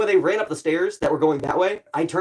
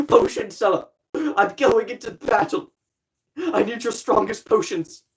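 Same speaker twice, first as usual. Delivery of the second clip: knight quote; 6 semitones higher than usual. Retold or imitated.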